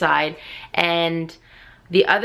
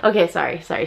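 ordinary talk

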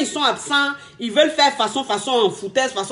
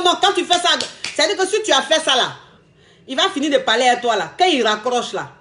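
ordinary talk

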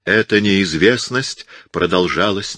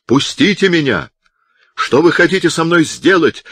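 higher and louder, straining to break free; desperate cry; 10 semitones higher, 4 dB louder than usual. A narrator's reading. Azzo struggles against the monks, crying out higher and louder. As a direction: cry out in alarm at the captors. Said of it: "крикнул Аццо".

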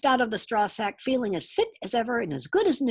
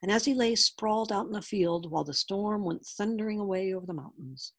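usual speaking voice

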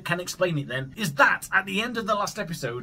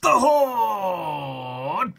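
a hint of a dramatic host voice; host persona name; 4 dB louder than usual. Host introducing himself to gaming fans, lightly dramatic. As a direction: announce yourself with a little flourish. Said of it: "I am your host".

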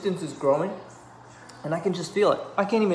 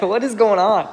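louder, higher, breaking into laughter; laughing; 4 semitones higher, 7 dB louder than usual. Real, not acted. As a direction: crack up mid-line. Laughing through mock-sad plea.

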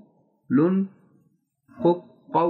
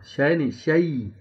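ordinary talk

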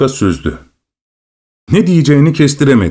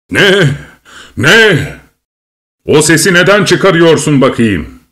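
roared question; 4 semitones higher than usual. A narrator's reading. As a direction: roar at the dwarf to stop the noise. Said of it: "diye gürledi kral".

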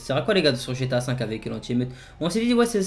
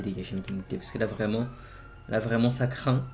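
ordinary talk, nothing marked

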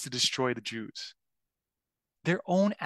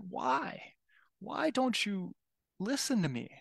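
imitated crying; 8 semitones higher, slower than usual. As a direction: plead as if holding back tears, pitched well up.

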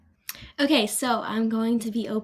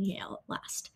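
whisper; 11 dB quieter, slower than usual. Real, not acted. Listing schools, trails off.

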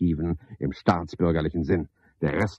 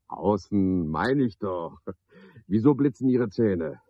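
ordinary talk, nothing marked

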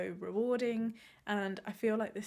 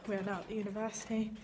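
ordinary delivery

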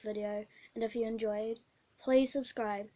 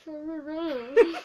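laughing through a stuffed mouth; cheeks stuffed full; 4 semitones higher, 6 dB louder than usual. Real, not acted. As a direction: giggle the words, cheeks crammed full. Chubby bunny marshmallow challenge.

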